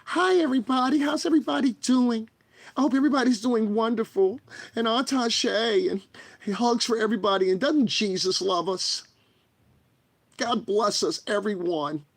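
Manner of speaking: mock a gentle feminine streamer greeting; high soft feminine voice. Mocking the voice his critics want, high and sweet. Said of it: "my natural voice octave was something like".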